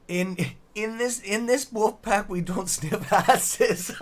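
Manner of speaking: high, slow words breaking into laughter; real laughter. Real, not acted. Streamer reading out a viewer's joke, laughing. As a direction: struggle to get the line out through real laughing. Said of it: "I'm laughing so fucking loud".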